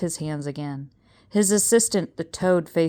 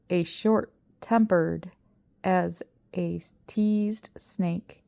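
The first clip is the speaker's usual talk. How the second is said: ordinary delivery, nothing marked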